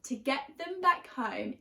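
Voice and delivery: ordinary delivery, nothing marked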